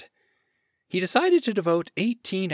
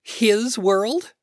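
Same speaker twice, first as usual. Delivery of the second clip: higher, louder outraged question; indignant protest; 7 semitones higher, 6 dB louder than usual. A narrator's reading. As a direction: protest in disbelief. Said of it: "the woman protested".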